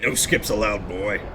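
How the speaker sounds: low stern father impression; Kratos. Game father forbidding his boy skips, deep and stern. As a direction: lay down the rule as the deep-voiced father. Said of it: "always does the Kratos one".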